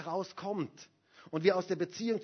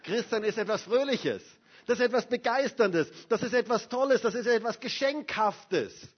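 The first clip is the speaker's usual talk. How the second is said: beaming, louder and higher with a laugh; joyful preacher, louder and higher; 6 semitones higher, 7 dB louder than usual. Acted. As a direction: let your face light up as you say it. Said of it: "Darfst deinem Gesicht mal Bescheid sagen".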